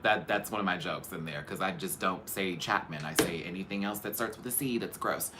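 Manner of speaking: ordinary delivery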